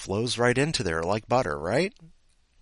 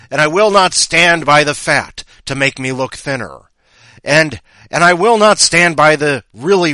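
building to a loud rant; ranting shout; 3 semitones higher, 11 dB louder than usual. Acted. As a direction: get louder with each pledge. Escalating ranting pledge.

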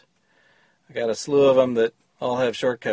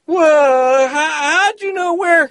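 amazed; 14 semitones higher, 5 dB louder, slower than usual. Acted. Startled character voice.

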